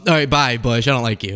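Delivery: usual speaking voice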